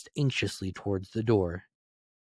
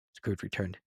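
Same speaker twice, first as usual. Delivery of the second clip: plain reading voice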